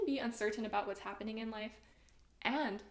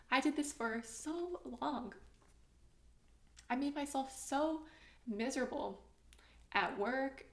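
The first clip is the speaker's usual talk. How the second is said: getting emotional; 4 semitones higher than usual. Real, not acted. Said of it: "Oh my gosh, I'm, like, getting emotional".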